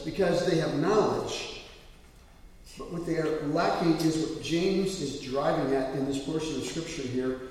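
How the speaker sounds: ordinary delivery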